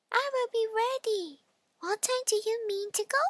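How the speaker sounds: high squeaky pig voice; eager little pig. Acted. The pig replies.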